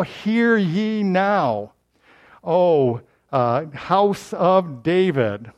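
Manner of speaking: slow, drawn-out official summons; official proclamation. Acted. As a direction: proclaim it grandly, stretching every word. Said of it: "he's got an official now".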